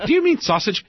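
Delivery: high, laughing question. Real, not acted. Host retelling his dinner, laughing out the question. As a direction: ask it through a laugh. Laughing while quoting himself.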